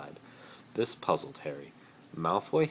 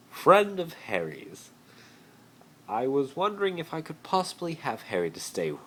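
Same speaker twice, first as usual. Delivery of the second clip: ingratiating Malfoy; 11 semitones higher, 6 dB louder than usual. Acted. Malfoy charming Uncle Vernon, high and sweet. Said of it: "looking for the right word".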